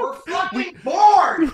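loud, high, slow shout. Real, not acted. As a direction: yell it out over the laughter. Shouted punchline amid laughter.

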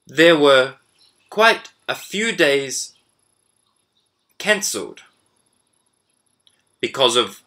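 measured dictation read with pauses between phrases; slow dictation. Shown as a demonstration. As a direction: read it out slowly for students to write down. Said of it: "I'm going to read it slowly".

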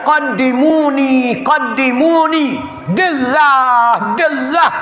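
high, drawn-out urging cry; urgent plea. Acted. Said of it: "dia kata".

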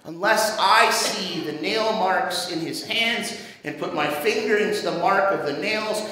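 normal talking voice

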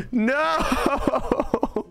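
high laughing no; laughing despair. Real, not acted. Gamer cracking up at failure, laughing cry. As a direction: laugh it out in defeat.

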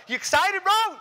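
high, loud shout at a buddy; pumped bro yelling in your ear; over-excited thrill-seeker; hyped-up rider. Acted. Pumped rider hassles nervous one.

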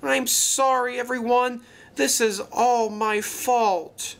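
high, wailing apology; distraught cry. A narrator's reading. Luke blaming himself for the crash, crying in distress. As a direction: wail with guilt, slowing at the end. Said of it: "cried Luke".

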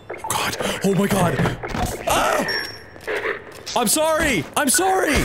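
high, panicked yelps of apology; breathless fright. Real, not acted. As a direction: blurt sorry in a panic. Panics after being caught.

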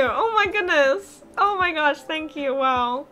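usual speaking voice

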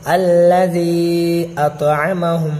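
"أَطْعَمَهُمْ" as at-a-ma-hum, short ain; long zee in "الَّذِي", short vowels in "أَطْعَمَهُمْ"; correct reading. Shown as a demonstration. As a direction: chant "الَّذِي أَطْعَمَهُمْ" without stretching the ain. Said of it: "اس کو پڑھنا ہے کیسے؟".

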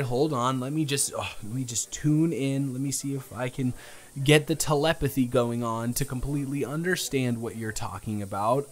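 usual speaking voice

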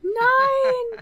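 high, drawn-out cry of no; high-pitched, loud howl of dismay; dismayed wail. Real, not acted. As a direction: wail it out in comic despair. Realizes no baby name is prepared.

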